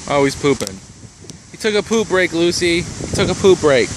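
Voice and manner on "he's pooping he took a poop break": usual speaking voice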